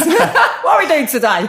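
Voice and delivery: laughing angry stylist impression; angry mock accent. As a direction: ham up a curt, hostile stylist. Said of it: "That angry sort of accent".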